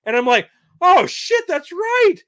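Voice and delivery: high, loud sudden realization; excited. Retold or imitated. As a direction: blurt the discovery, worked up. Retelling his realization, worked up.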